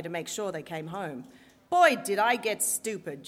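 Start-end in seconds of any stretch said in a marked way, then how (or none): 1.7-3.3 loud, brassy Brooklyn mama; a Brooklyn accent, belted out; strident Brooklyn accent. Retold or imitated. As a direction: play the Brooklyn mob mama complaining. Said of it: "in her strident Brooklyn accent".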